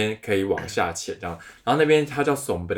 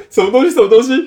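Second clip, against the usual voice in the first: startled; 14 semitones higher, 12 dB louder, faster than usual. Retold or imitated. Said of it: "我就说".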